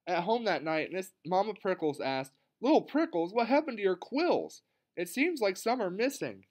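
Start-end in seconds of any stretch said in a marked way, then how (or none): 2.6-6.4 high-pitched worried mother; concerned surprise. A narrator's reading. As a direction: ask as a startled mama, voice lifted. Mother startled by missing quills.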